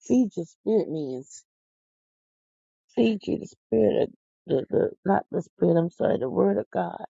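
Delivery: ordinary talk, nothing marked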